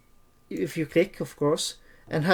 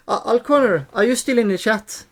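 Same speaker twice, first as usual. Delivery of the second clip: higher, louder call to a viewer; 5 semitones higher, 3 dB louder than usual. Real, not acted. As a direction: call out across the room. Calling to a viewer in chat.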